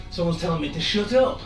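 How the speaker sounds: ordinary talk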